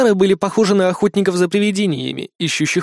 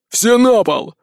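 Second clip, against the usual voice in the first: high, loud barked order; shouting orderlies; 7 semitones higher, 4 dB louder, slower than usual. Acted. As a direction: yell the command at the crowd. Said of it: "заорали санитары".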